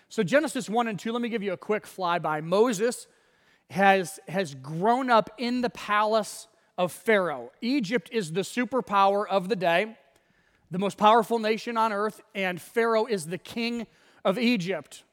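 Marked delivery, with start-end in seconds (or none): none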